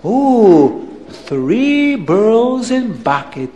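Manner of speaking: slow, cooing wonder; awed Abdallah. Acted. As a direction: marvel in drawn-out accented oohs. Abdallah awed by third pearl.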